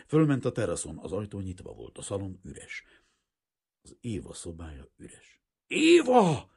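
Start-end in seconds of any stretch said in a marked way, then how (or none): 5.7-6.5 very high, very loud cry; desperate call. A narrator's reading. Kazimír calls Éva through the empty house, desperate shout. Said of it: "kiáltotta valami rettenetes belső ijegységgel".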